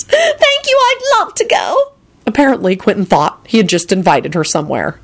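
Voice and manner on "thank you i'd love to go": very high, thrilled character squeal; excited screech. A narrator's reading. Woman accepts an invitation never made, screeching happily. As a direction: gush in a sky-high delighted voice. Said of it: "Solange screeched and clapped her hands".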